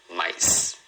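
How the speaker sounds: "mais" (mice) in a single beat; "mais" with no vowel after the S; one syllable. Shown as a demonstration. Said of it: "uma sílaba".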